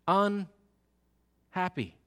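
drawn out very slowly, deflated. Retold or imitated. Preacher voicing a teen's empty ending, slow deflated sigh. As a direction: let the word sag out slowly. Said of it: "Maybe you felt".

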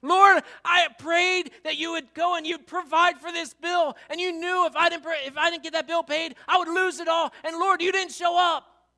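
a believer furious that God didn't pay the bill; pained angry shout. Acted. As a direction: shout the hurt straight at God. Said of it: "You hear the pain in her voice".

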